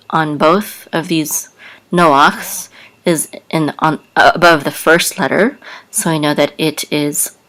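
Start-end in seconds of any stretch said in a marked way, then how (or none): none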